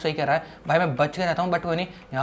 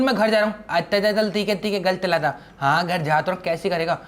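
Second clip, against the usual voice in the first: ordinary talk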